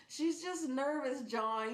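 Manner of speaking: high, drawn-out soothing plea; cooing reassurance, retold. Owner calming John about the cat, high slow coaxing. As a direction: soothe him in a high mock-sweet voice.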